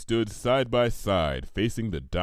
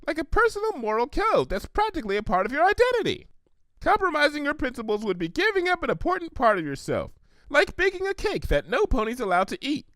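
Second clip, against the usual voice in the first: high, quick, chirpy pony voice; bubbly Pinky voice. Acted. Pinky showing Applejack she understands, high and eager. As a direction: explain it eagerly, all sunshine.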